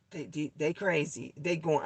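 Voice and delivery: a hint of the manager, quiet and mumbled, words trailing away; mumbled imitation of the manager. Retelling the manager's shrug-off to viewers, quiet mumbled mock. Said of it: "she was like".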